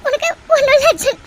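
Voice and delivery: high, startled, sobbing relief; tearful crying. Acted. Woman seeing injured loved one wake, crying high. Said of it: "எதுக்குடி அழுதுட்டு இருக்க".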